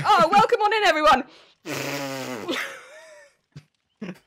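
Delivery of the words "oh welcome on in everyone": loud, high, gushing welcome; over-the-top welcome. Retold or imitated. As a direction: greet everyone at full blast. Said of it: "I'd be like".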